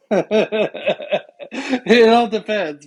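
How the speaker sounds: slow, laughing through the words. Real, not acted. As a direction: chuckle as you say it. Laughs while answering.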